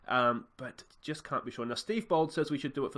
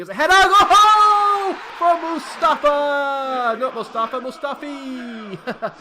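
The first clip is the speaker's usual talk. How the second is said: huge high shout of joy at the goal; goal celebration; 13 semitones higher, 16 dB louder, much slower than usual. Real, not acted. Gamer shouting at his goal on stream. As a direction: scream it out as the header goes in.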